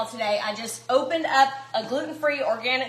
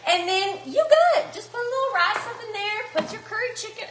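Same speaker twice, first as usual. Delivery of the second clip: talking through a laugh, higher; 8 semitones higher than usual. Real, not acted. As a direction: giggle it out.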